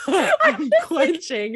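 slow, laughing imitation; laughing mock quote. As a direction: quote her while laughing. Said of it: "So when you're saying".

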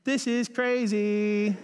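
high, loud and slow, in wonder; stretched-out amazement; amazed. Retold or imitated. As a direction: savor the disbelief. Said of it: "I'm like".

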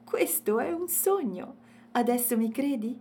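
high, loud, lively character voice; amused little elephant. A narrator's reading. Elephant invites them to meet his friends, lively.

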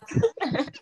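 high happy laugh; giggling high with joy. Real, not acted. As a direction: laugh it off in delight. Laughing about the offer.

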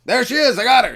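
high mock cry of triumph; proud hunter finding his prize; mock triumphant. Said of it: "walk through the woods".

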